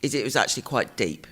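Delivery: usual speaking voice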